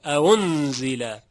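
اعنزله slow, second hamza softened into a u glide; slow recitation of اعنزله, no hard catch before u; eased second hamza. Shown as a demonstration. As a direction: stretch اعنزله and loosen the second onset.

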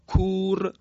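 careful demo of back k in "کور"; near-correct kaf. Shown as a demonstration. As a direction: keep "کور" free of palatal softening. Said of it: "به کاف صحیح نزدیکتره".

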